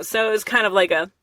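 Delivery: usual speaking voice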